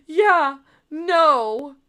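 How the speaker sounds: high, loud mock wail; mock tearful denial. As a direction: imitate an overwrought, crying girlfriend. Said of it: "like, crying and, and playing the victim".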